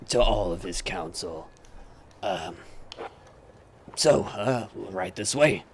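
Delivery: slow, hesitant in-character voice, slightly low; softly spoken, unhurried royal escort; polite guide character. Acted. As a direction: usher guests along with pauses to think. Game master plays Justin, the guide.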